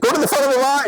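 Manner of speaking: high, eager command from a giddy fan; excited fan. Retold or imitated. Star-struck check-in clerk sends him ahead, giddy and high. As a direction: wave him ahead, thrilled. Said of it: "And she was like".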